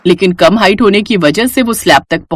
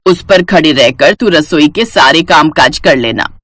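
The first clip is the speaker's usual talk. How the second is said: put-on low-pitched older woman giving orders; lower, commanding elder's role; bossy mother-in-law; 4 semitones lower than usual. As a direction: hand out chores with authority.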